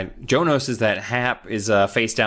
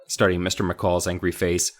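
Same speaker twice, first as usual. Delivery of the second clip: ordinary talk, nothing marked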